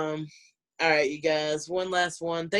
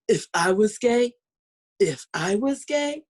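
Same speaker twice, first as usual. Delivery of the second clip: drawn-out, higher straight-woman imitation; mock insinuating; 4 semitones higher, 4 dB louder, slower than usual. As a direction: stretch it out slowly, pitched up, imitating her. Acted quote of a straight woman.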